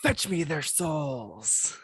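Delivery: slow, drawn-out eerie command; creepy zombie-game announcer. Shown as a demonstration. Host imitating a zombie game line, creepy announcer. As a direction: draw out the last word ominously. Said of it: "what the guy says when the dogs are coming".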